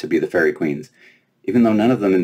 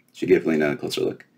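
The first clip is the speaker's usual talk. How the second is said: ordinary delivery, nothing marked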